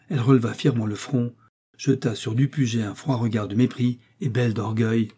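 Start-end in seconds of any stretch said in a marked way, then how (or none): none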